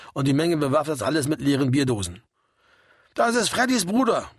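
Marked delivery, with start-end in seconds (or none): 3.1-4.3 high-pitched raised voice, little extra volume; narrator's restrained yell; mild bellow. A narrator's reading. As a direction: holler an introduction over the band. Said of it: "brüllte Karl".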